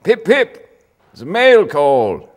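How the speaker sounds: high, very loud pip pip cheer; cheery posh soldier. Retold or imitated. Jolly soldier calling out mail call, loud and high. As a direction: chirp it like a jolly Englishman. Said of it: "occasionally they'd go".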